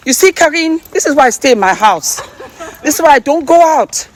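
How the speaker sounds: high, loud, worked-up rant; agitated. Real, not acted. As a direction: vent it, rattled and loud. Shaken rant after fleeing.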